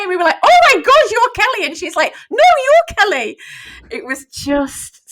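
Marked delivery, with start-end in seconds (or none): 0.4-1.7 very high, loud retold squeal; full-volume, thrilled exclamation; excited outburst. Retold excited first meeting. 2.3-3.4 high, loud mimicked squeal; shrieked playful insistence; excited retort. Retold excited reply, both shouting.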